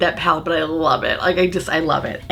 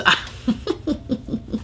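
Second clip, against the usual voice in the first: breathy laugh; 5 semitones higher, 5 dB quieter than usual. Real, not acted. Laughs at own joke.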